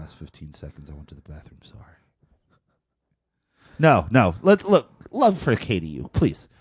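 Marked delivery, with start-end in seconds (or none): 0.0-2.0 whisper. Retold or imitated. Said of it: "D Js on there that are like".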